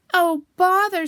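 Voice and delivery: high, very loud lament; loud storybook wail; exasperated distress. Acted. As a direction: cry out in frustration while wedged. Pooh stuck in the hole.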